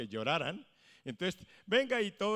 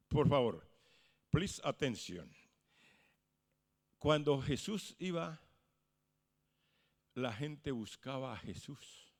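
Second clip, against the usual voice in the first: usual speaking voice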